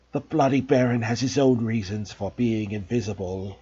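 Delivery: low, disguised hoarse whisper. A narrator's reading. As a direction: pretend to be the Baron. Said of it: "in a hoarse whisper".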